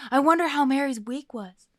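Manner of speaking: bright, loud eager fan voice; eager listener. Retold or imitated. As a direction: play the curious listener. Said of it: "going like".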